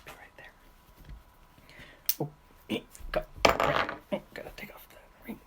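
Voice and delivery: unvoiced whisper, far from the mic; whispered aside. Real, not acted. Stepping away mid-video to fetch a sock, whispering to herself. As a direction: murmur to herself while busy off camera.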